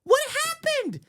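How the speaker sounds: suddenly squeaky high voice; real involuntary squeak in the voice; high-pitched. Real, not acted. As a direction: voice jumps way up on its own. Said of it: "Oh, I'm getting high-pitched".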